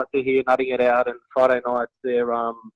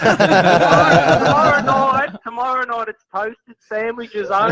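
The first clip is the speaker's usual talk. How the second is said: laughing; 8 semitones higher, 6 dB louder than usual. Real, not acted. Cracking up while joking.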